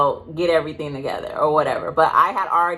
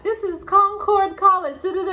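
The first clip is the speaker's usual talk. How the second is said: high, bright mock official voice; lightly mimicked receptionist answering the phone; imitating receptionist; 13 semitones higher than usual. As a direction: play the college caller, pitched way up. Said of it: "they're like".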